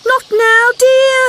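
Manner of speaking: put-on high, loud brush-off to her child; distracted mother fobbing him off; mother frog voice. A narrator's reading. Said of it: "said the little frog's mother".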